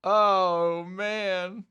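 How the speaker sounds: normal talking voice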